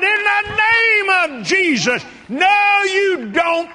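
loud, high, slow commanding shout; hollered rebuke. Retold or imitated. Preacher rebuking the earthquake, hollered loud and slow. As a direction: holler it down with authority. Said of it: "I hollered".

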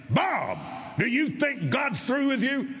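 high, forceful shout; roaring preacher. Retold or imitated. Healing preacher confronts the man come for prayer, roaring. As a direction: bellow it like a roaring lion. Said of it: "he was not speaking softly".

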